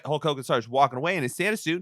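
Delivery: ordinary talk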